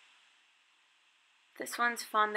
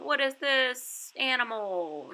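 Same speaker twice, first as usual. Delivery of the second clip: slow, higher teacher question; 5 semitones higher, slower than usual. Retold or imitated. Teacher asking young pupil online, slow and higher. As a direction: ask it slowly like to a small child.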